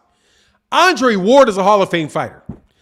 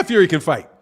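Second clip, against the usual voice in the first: ordinary delivery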